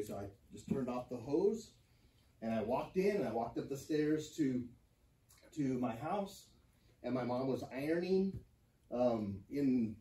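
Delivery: usual speaking voice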